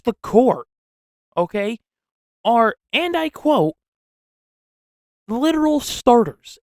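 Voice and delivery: high, loud, worked-up disbelief; incredulous rant. Real, not acted. Incredulous rant about the narrow win.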